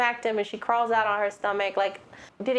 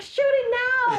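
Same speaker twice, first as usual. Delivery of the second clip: much higher, panicky "shoot it now"; frantic urging; 13 semitones higher, 3 dB louder than usual. Retold or imitated. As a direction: yell it up high in a mock panic. Frantic family shooting at gremlin.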